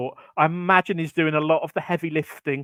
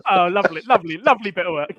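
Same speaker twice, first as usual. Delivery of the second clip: laughing; 5 semitones higher, 4 dB louder, faster than usual. Real, not acted. Laughing at the sausage joke.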